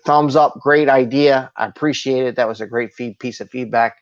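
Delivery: ordinary talk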